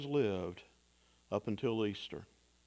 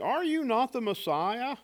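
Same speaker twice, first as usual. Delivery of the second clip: deriding jeer; 8 semitones higher than usual. Acted. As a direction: sneer it up at him like a dying criminal. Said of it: "kept deriding him".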